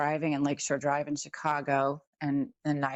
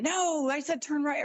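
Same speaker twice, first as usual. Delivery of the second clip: sharp, much higher mock instructor's correction; driving teacher; 10 semitones higher than usual. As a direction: play a strict driving teacher snapping a correction. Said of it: "the teacher went".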